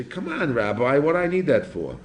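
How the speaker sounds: imitating a doubting student; mock objection. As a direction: whine like a student waving the rule off.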